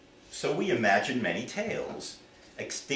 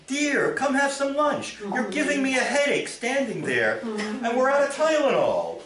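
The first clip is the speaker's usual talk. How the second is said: much higher voice, mildly annoyed; the wife complaining of a headache; high wife's voice with a touch of irritation; 13 semitones higher, 3 dB louder than usual. Acted.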